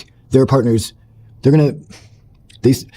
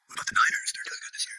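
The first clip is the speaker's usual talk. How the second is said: whispered deflection; sheepish whisper; 11 dB quieter, faster than usual. Retold or imitated. Husband deflecting to football, whispering. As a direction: mutter the excuse under the breath.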